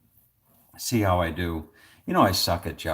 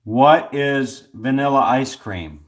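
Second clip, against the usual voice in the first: loud, slow, higher quiz answer; deliberate, raised contestant's reply; calling out answer; 5 semitones higher, 9 dB louder, slower than usual. Real, not acted. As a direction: project it slowly for the machine to catch.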